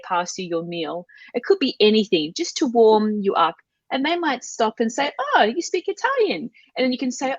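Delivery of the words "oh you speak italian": high-pitched, acted "oh!" of discovery; pleasantly surprised. Acts out surprised cabin crew.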